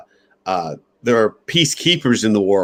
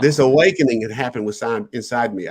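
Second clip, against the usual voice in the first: ordinary talk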